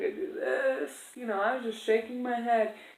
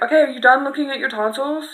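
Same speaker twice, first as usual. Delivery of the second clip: impatient nurse; 4 semitones higher, 10 dB louder, faster than usual. Retold or imitated. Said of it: "seemed to get mad at me".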